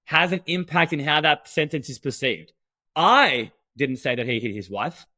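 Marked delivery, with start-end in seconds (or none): 3.0-5.1 "I" drawn out and punched loud, then a pause; long, emphatic "I" set apart from the rest; stress on I. Shown as a demonstration. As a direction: lean hard on the word "I", then say the rest. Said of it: "The word that you emphasize".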